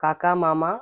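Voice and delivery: ordinary delivery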